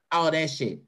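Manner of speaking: ordinary talk, nothing marked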